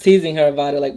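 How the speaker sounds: usual speaking voice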